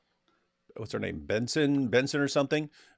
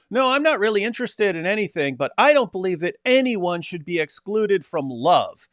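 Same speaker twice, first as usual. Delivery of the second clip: imitating a child; 7 semitones higher than usual. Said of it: "And he goes".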